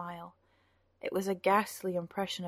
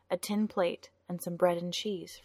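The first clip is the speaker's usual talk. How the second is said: ordinary delivery, nothing marked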